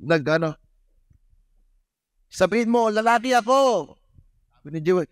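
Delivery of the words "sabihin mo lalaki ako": higher, teasing host voice. Retold or imitated. Host teasing the boy at the party, higher. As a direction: coax the boy playfully.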